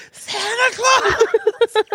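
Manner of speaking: high, drawn-out excited shout; kids screaming. Retold or imitated. Father imitating his boys screaming at a lady in red, high and excited. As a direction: shriek it like thrilled little boys. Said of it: "both the boys scream".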